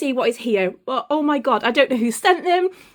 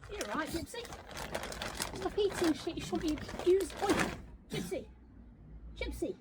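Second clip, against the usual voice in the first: calling the dog; 3 semitones higher, 16 dB quieter than usual. Real, not acted. Scolding the dog from afar.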